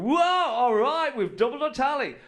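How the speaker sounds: loud, high, stretched whoop; mock celebration. Countries celebrating a second medal, mock elated cheer. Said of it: "Most countries would be going".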